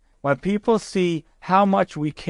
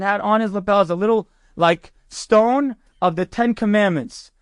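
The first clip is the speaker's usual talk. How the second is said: normal talking voice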